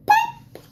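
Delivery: sharp high pop; high pop sound. Acted. Button pops in story.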